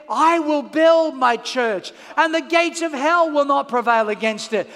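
slow, weighty proclamation; quoting Jesus with slow emphasis; solemn quote. Retold or imitated. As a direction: declare it like scripture, slowly. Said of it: "But Jesus said".

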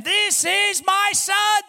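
high, slow, loud proclamation as God; slow loud divine declaration; booming voice of heaven. Acted. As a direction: announce it like heaven speaking. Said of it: "resonates the voice of heaven".

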